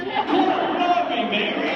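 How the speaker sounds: loud, hammed-up fake Cockney. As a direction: overdo a bad British accent.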